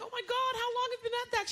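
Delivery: high mock-amazed ask; amazed question. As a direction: gasp it out in disbelief. Asking smoking old woman.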